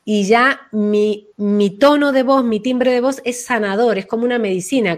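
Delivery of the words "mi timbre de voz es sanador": ordinary talk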